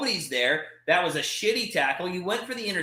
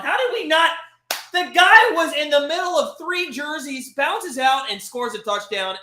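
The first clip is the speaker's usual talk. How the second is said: much higher and louder, incredulous; disbelieving rant; 9 semitones higher, 7 dB louder than usual. Real, not acted. Fan ranting to viewers about a missed tackle, incredulous. Ranting after conceded touchdown.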